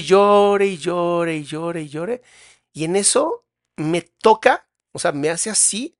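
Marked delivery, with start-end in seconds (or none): 0.0-2.2 slow, drawn-out repetition; droning, elongated singsong of nonstop weeping; endless crying retold. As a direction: stretch each repeat to show it going on and on. Mimes crying on and on.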